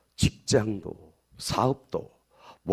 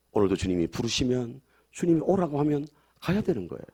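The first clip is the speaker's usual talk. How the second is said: ordinary talk, nothing marked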